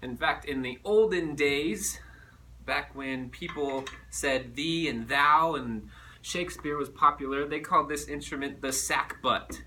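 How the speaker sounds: ordinary talk, nothing marked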